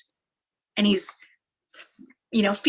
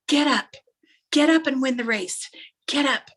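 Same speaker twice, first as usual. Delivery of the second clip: urging dad; 5 semitones higher, 4 dB louder than usual. Retold or imitated. Dad urges fallen son on.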